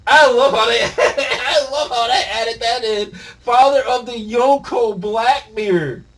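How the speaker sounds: high, loud, slow laughing; laughing hype. Real, not acted. Reader laughing over the Blackbeard twist, laughing excitedly. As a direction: laugh through it in disbelief.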